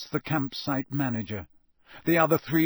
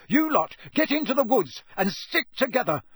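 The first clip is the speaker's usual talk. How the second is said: high, loud shout over the din; father shouting over the noise to his sons; 10 semitones higher, 6 dB louder than usual. Acted. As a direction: yell above the chaos. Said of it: "Mister Weasley shouted over all the noise".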